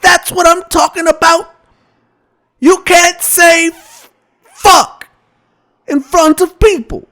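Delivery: mild mock yell; angry Cosby. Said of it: "That's when Bill got pissed and said".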